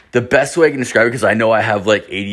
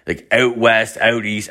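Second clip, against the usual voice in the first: usual speaking voice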